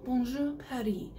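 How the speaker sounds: French-accented "Bonjour Paris", silent s in Paris; playful French accent on both words. Shown as a demonstration. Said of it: "with the French accent".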